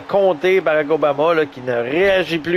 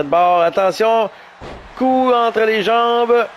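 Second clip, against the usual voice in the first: high, lively fight commentary; live play-by-play; 5 semitones higher than usual. Acted. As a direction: call each hit as it lands.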